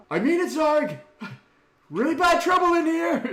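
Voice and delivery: high, loud desperate yell. Acted. Renard yelling back out the doorway to Zarg. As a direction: shout back through the doorway, urgent. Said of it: "he yells back out the doorway".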